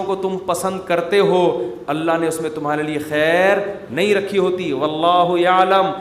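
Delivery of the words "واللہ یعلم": slowly intoned Arabic, a little louder; slow Quranic recitation. Acted. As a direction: draw the verse out like a recitation. Quranic verse recited in sermon. (آہستہ قرآنی تلاوت کا انداز)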